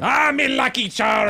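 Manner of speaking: hammed-up Irish accent. Mocking his co-host's drift into an Irish accent. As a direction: shout it like a cartoon leprechaun in Irish. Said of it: "Stay in one dialect".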